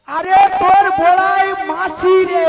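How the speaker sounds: loud, slow, stretched-out words; exasperated loud correction. Acted. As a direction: call it out as if to someone hard of hearing. Shouting to one hard of hearing. (কানে কম শোনা মানুষকে চেঁচিয়ে বোঝানো)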